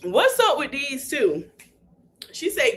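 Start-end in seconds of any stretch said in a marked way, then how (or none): 0.0-1.5 slow, raised-pitch mock puzzlement; baffled. Imitating an onlooker watching the couple bicker, mock baffled.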